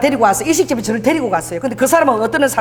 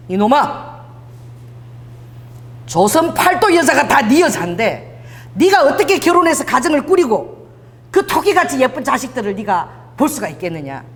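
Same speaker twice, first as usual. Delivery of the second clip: higher-pitched affectionate telling-off; God's fond scolding; 5 semitones higher than usual. Acted. Preacher voices God to a wayward man, fond scolding. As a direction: scold like a parent who loves him.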